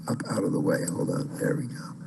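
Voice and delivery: whisper. Real, not acted. Muttering while adjusting screen.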